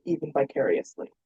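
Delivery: plain reading voice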